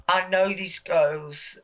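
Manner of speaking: slow, drawn-out mock British accent. Imitating a drag queen's confessional, British accent. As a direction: put on an exaggerated British voice. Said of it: "I give her British accent".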